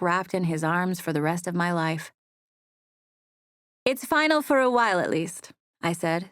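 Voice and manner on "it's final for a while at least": logical. Acted. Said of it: "still being logical".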